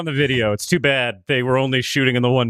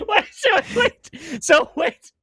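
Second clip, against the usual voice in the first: laughing disbelief; slower than usual. Real, not acted.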